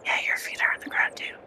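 hushed whisper, leaning in. Pretend. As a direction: whisper it close to the mic. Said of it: "you're gonna whisper".

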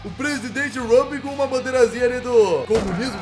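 usual speaking voice

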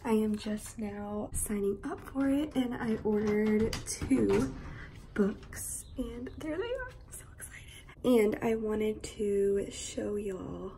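soft, half-whispered chat; hushed morning voice. Real, not acted. Unboxing a book package early morning, hushed. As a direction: keep it hushed so nobody wakes.

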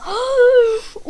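loud, high gasp; startled gasp. Real, not acted. Player narrowly escaping mid-flight, startled gasp. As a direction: gasp sharply in alarm. Near miss in the game.

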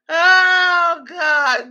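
loud high laugh into a long "Oh, God"; real hard laughing. Real, not acted. Streamer cracking up at her own chat, loud laughing exclamation. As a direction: howl with laughter, then wail it out.

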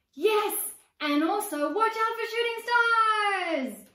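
high, loud, slow eager call; excited warning. Acted. Little sister warning her brother about shooting stars, excited. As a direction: warn him with wide-eyed glee.

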